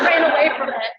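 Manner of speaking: laughing through the words, high and loud. Real, not acted. Speaker laughs about running from a scary moment. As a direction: laugh it off as you say it.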